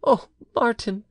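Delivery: high, voiced wife's exclamation; exclaiming wife. A narrator's reading. Wife cries out to her returned husband, high exclaiming. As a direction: cry out his name as the wife. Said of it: "Bertrande exclaimed".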